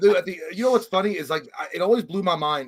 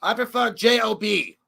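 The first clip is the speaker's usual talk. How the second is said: lightly voiced dismissive grouch, pitched up; mock-curt put-on answer, a bit higher; Loomis; 5 semitones higher than usual. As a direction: brush the question off in character. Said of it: "like, what Loomis would say".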